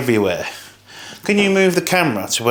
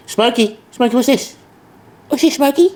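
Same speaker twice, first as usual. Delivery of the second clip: much higher cooing voice to the cat; pet talk; 10 semitones higher than usual. Real, not acted. Owner cooing at his cat Smokey, high pet voice.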